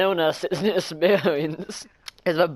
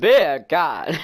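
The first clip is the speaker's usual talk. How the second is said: louder Jamaican accent, beer pushed; 6 dB louder than usual. Shown as a demonstration. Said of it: "say beer can in a Jamaican accent".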